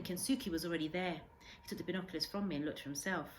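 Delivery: plain reading voice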